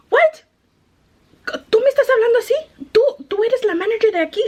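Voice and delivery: high, fast, incredulous mock customer; indignant customer. Retold customer challenging the manager.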